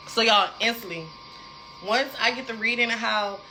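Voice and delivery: ordinary talk, nothing marked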